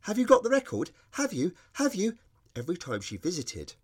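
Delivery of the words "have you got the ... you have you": high, loud, eager pestering; a six-year-old begging to know if it's here; excited child shouting. Retold or imitated. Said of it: "understandably excited, shouting".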